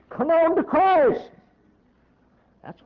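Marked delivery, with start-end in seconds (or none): 0.1-1.3 high, drawn-out preacher's cry; urgent exhortation. Real, not acted. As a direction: call them forward with a raised, stretched voice. Preacher exhorting listeners to come.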